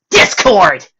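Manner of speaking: loud angry call; yelling. Acted. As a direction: shout his name. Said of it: "Dash yelled".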